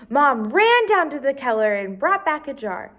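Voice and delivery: usual speaking voice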